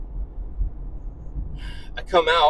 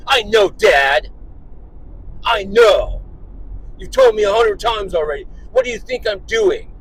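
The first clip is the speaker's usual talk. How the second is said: mock exasperated son, louder and higher; exasperated kid; 5 semitones higher, 4 dB louder than usual. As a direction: imitate a fed-up kid talking back to dad. Retells son's exasperated back talk.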